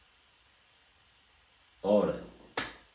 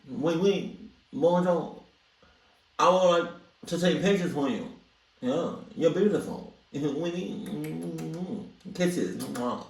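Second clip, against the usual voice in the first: lightly put-on French accent, sleazy charm; a hint of French creep, playful; fake French accent; 5 semitones higher, slower than usual. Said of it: "I was trying to go for, for French".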